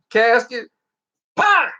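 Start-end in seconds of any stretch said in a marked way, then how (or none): 1.4-1.7 mock popping bang, higher and louder; explosion pop. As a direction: make the casket go pop. Casket rigged to blow.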